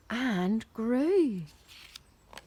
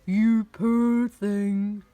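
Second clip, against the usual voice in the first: loud, slow, huge voice; booming giantess; 7 dB louder, much slower than usual. A narrator's reading. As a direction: boom out slow sympathy. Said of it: "boomed the giantess".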